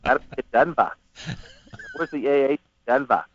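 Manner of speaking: mock Aussie with dropped r; playful Australian impression; imitated Australian accent. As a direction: put on a broad Australian accent. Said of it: "I thought that was Australia".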